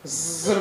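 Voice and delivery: long buzzing hiss of escaping fizz; imitated bottle fizz. Father yanks the bottle cap off, imitated fizz. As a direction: mimic a fizzy bottle bursting open.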